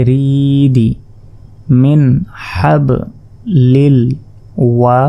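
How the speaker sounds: usual speaking voice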